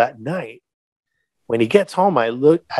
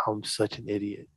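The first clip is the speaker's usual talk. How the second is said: self-reproach; 3 semitones lower, 14 dB quieter than usual. Retold or imitated. Regretting it next morning.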